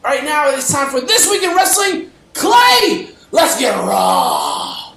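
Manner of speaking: loud, high wrestling announcer; hype announcer shout. Acted. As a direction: yell it like a ring announcer. Hype segment intro.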